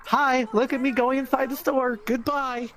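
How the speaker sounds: high, singsong mock greeting; mock-cheery taunt. Bike rider waves at passing trooper outside store, mock-cheery. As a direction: call out with exaggerated friendliness. Waving mockingly at passing officer.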